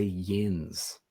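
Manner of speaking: "yins" as one drawn-out syllable, rhyming with "bins"; Pittsburgh "yins". Shown as a demonstration. Explaining Pittsburgh's plural you, "yins" said as one slow syllable. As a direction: stretch "yins" slowly in a single syllable. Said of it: "they say yins".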